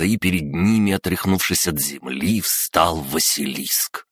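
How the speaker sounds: ordinary delivery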